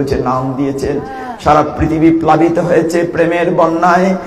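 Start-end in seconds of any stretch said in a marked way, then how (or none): none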